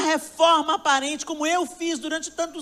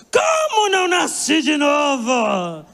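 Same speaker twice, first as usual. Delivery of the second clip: louder, slow, indignant shout; outraged shout; 6 dB louder, much slower than usual. Retold or imitated. Daughter retorting to her mother, outraged shout. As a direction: fling it back at your mother, offended. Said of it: "Quase fiquei endemoniada".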